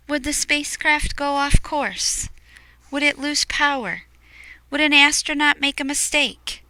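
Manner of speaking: put-on wide-eyed questions to young listeners; suspenseful questions. A narrator's reading. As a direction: raise the tension with each question. Suspense questions read aloud.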